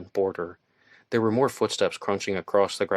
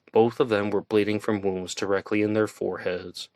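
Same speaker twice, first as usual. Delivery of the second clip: ordinary delivery, nothing marked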